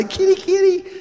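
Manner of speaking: high-pitched mock kitty call; coaxing a cat. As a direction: pretend to coax a lion like a house cat. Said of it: "try to say".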